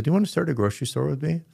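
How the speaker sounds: quick, high, eager question; eager pitch. Retold or imitated. Pastor eagerly asking Judy to start a grocery store, fast and bright. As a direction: rush the offer out with mock excitement.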